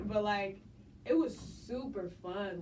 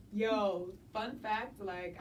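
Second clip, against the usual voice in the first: normal talking voice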